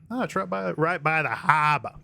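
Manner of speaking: put-on Boston accent; attempted New York accent that lands Bostonian. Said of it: "That is Bostonian".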